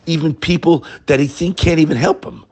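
ordinary talk, nothing marked

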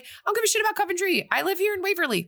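high, fast, brushing it off; dismissive Cameron. Retold or imitated. Cameron refuses to save Coventry, high and fast dismissal. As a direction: rattle it off like a bratty teen. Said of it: "And Cameron's like".

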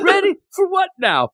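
high, puzzled echo of ready for what now; clueless reply. Retold or imitated. Re-quoting Mash's clueless answer, high and puzzled. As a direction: toss it off high and oblivious. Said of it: "you got cream".

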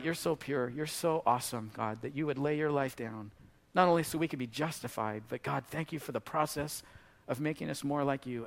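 hushed closing prayer. Acted.

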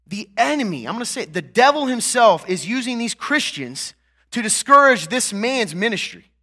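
preacher rising to full force, pausing to let it land; fiery preaching. Acted. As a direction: drive the point home to the congregation.

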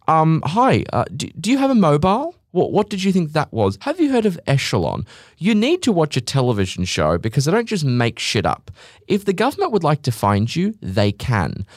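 high, sneering "hi, do you have a mobile" scorn; sarcastic mock politeness. Podcast host mocks people who fear tracking, high sarcastic scorn. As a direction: talk down to them like they're idiots. Sarcastic rant at vaccine conspiracists.

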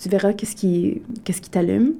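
retelling his words in a whisper; whispered quote. Retold or imitated. The lyricist encouraging Alexis, quoted in a whisper. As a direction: drop to a hush for the lyricist's line. Lyricist's advice retold, voice drops.

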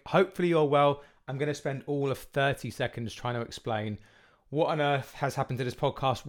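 ordinary talk

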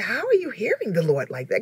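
ordinary talk, nothing marked

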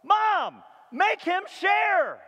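put-on high, loud child's shout, share drawn out slowly; child hollering to mom for fairness; whining kid. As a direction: yell like a kid tattling to mom. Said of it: "Every parent knows this call".

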